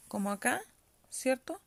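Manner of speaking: usual speaking voice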